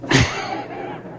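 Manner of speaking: risa aguda sin voz, cortita; ríe bajito por la broma; risa susurrada. Real, not acted. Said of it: "risa tras la broma". (risita soplada y aguda)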